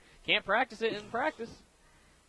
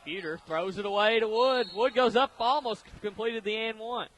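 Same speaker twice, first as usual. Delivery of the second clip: louder, rising call of the action; excited play-by-play; 4 semitones higher, 5 dB louder than usual. Real, not acted. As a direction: ride the play up to its peak. Live call of a near basket.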